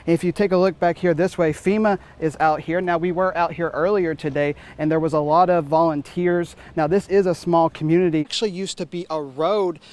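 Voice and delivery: loud, energetic on-the-scene reporter read; live field report. Acted. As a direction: report it live from the flood site, projecting over the scene. Live field report at flooded house.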